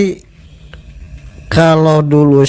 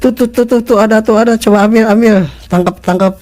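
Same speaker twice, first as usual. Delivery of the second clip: higher-pitched, rapid repeated orders; retold urgent command; 7 semitones higher than usual. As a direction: imitate Dad urgently ordering a catch. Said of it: "sama bapak saya tuh diperintah".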